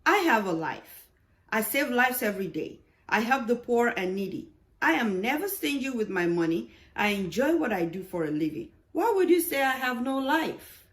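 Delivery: doctor defending himself emphatically; emphatic. Acted. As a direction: insist forcefully, rising at the end.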